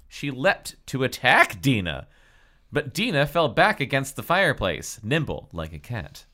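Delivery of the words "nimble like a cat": ordinary delivery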